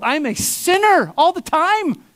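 high, exasperated confession. Retold or imitated. Pastor tells the heretic he sins, high exasperation. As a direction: throw up your hands, pitched high. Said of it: "I'm just like".

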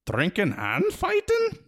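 the wee men guessing together; unsure Feegle crowd. A narrator's reading.

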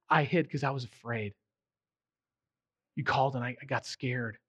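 soft, low, fading admission; honest confession. Retold or imitated. Adam admitting his fear to God, soft and fading. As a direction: admit your fear quietly. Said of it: "Adam's being honest".

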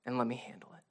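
low, hushed, tender offer; gentle reassurance. Acted. God reassuring a worried believer, softly tender. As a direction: let it drop to a soft, caring near-whisper.

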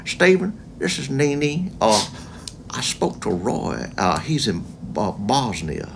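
slow, soft, refined Southern lady; grandmother's Southern accent. Retold or imitated. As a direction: imitate a grandmother's genteel Southern voicemail. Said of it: "these voicemails from her".

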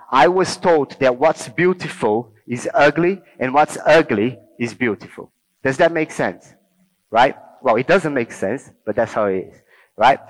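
ordinary talk, nothing marked

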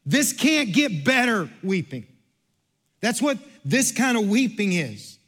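a pastor working up the congregation; rising preaching. Acted. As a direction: preach it with growing fire. Preaching builds toward climax.

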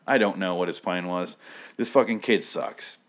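normal talking voice

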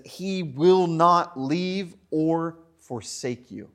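drawn out, each word weighed; slow it right down like a promise; slow solemn quote. Shown as a demonstration. Preacher reading the promise slowly to the congregation.

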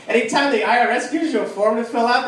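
ordinary talk

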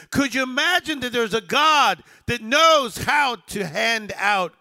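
loud, high-pitched, driving sermon delivery; rousing preacher building question on question; full-force preaching. Real, not acted.

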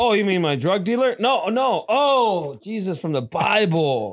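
mock confused ex-con. Mocking released inmate voice.

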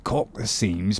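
quiet muttering. A narrator's reading. Carpenter recites task list.

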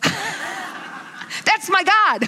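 high-pitched, stretched-out delight; exultant. Real, not acted. Preacher cheering Luke walking out of the haze, exultant. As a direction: crow it with proud glee. Exulting over the hero's survival.

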